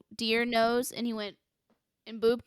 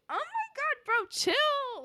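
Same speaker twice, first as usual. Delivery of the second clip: high, flustered mock protest; slower than usual. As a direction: imitate a flustered girl, voice shooting way up. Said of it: "she got flustered".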